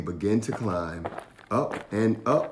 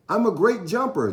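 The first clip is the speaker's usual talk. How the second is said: put-on high proud little-boy voice; boy voice, chest puffed out; Jabari, boastful boy; 12 semitones higher, 5 dB louder, faster than usual. A narrator's reading. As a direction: brag like a small kid. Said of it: "Jabari said".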